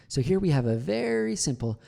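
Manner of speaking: VERY stretched and pitched high; stress on VERY. Shown as a demonstration. Coach shows how stress changes meaning, VERY emphasized. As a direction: lean hard and long on VERY. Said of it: "Depending on what word you stress".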